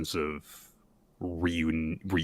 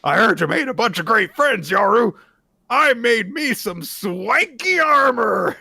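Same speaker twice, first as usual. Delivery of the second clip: smug Denji impression; 14 semitones higher, 9 dB louder than usual. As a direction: gloat up high like a cocky kid. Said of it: "And Denji says".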